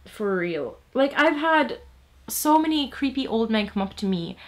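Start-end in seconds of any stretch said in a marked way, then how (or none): none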